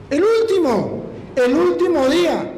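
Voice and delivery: slow down and ring it out in triumph; testimony climax, jubilant and drawn out; excited. Real, not acted. Climax of his exam story.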